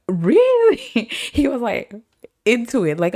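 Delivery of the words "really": very high, loud squeal of interest; high excited squeal; delighted really. Retold or imitated. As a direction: gush it out, thrilled. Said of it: "'cause he was like".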